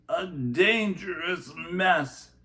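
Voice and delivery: a hint of a worried grandfather, drawn out; grandfather's remark. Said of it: "he said".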